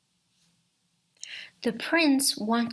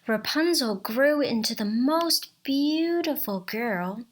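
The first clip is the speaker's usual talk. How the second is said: ordinary delivery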